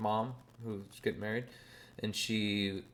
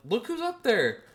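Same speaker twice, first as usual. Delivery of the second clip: pushing, high and louder; high insistent aunt; 7 dB louder than usual. Retold or imitated. Aunt pushing mom to look, high. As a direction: urge her to look.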